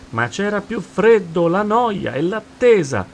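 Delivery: high, loud answer; full-voiced reply. A narrator's reading. Friends answering dolphin in the storm, full-voiced reply. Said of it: "risposero gli altri a voce distesa".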